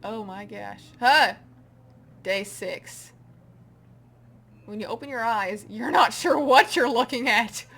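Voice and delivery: ordinary delivery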